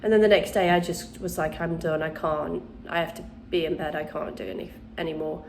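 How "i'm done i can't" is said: ordinary delivery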